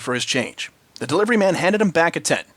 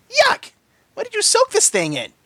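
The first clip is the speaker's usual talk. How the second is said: disgusted Pinky. A narrator's reading. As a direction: squeal in disgust.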